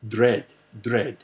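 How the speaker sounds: usual speaking voice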